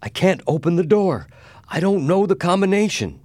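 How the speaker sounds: high, loud desperation; desperate. A narrator's reading. Mister Adams fails to open the safe, desperate. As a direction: cry out helplessly, pitch leaping up. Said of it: "he said in desperation".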